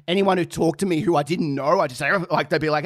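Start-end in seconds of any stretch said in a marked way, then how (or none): none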